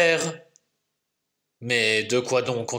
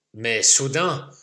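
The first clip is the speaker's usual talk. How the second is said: plain reading voice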